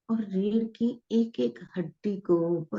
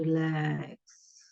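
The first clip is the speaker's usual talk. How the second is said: slow, tender repeated relax, fading softer; lovingly; 3 dB quieter, slower than usual. Shown as a demonstration. Guide showing listeners how to tell the body to relax, lovingly. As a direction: coax the body to let go, slowly. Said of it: "और प्यार से बोलेंगे".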